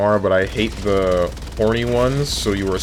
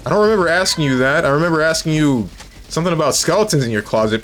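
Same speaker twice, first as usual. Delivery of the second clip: high, quick-starting indignant denial; defensive protest; 7 semitones higher, faster than usual. Real, not acted. Streamer disputing a chatter's memory, high and indignant. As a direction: push back in a raised pitch.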